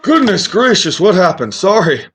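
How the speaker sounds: put-on high-pitched, flustered old wizard; startled old wizard. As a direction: wake up befuddled and amazed, pitched high. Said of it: "the wizard just stands up".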